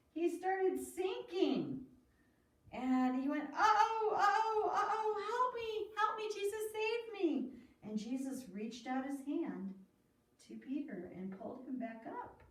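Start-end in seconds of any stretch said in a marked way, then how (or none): 0.1-1.9 very slow, drawn out, higher; slow suspense. A narrator's reading. Suspense in story to children. 3.4-7.5 much higher, louder frightened uh-ohs; panicked cry for help. Acted. Peter sinking, crying for help.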